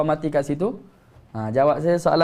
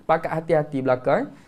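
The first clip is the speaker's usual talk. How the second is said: usual speaking voice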